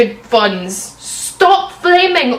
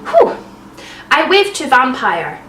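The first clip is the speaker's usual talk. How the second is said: normal talking voice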